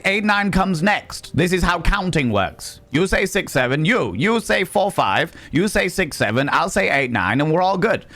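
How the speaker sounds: mock patient explaining. Vlogger teasing angry kids, sing-song patronising. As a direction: explain counting like to a toddler. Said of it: "and I'm like".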